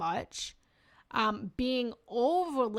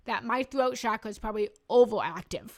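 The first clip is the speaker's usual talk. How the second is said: fast and louder; fast talking; 6 dB louder, faster than usual. Real, not acted. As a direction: push the words out quickly. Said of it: "exactly what I'm doing right now".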